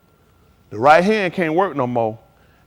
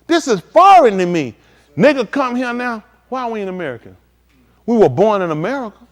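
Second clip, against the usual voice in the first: ordinary delivery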